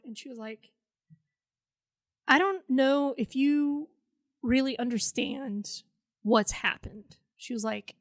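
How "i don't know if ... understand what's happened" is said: slow, deliberate delivery; imitating a wife spelling it out slowly; retold measured confrontation. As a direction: space the words out carefully. Wife slowly talking down to kid.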